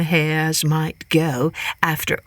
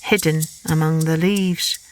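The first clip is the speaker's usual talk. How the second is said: usual speaking voice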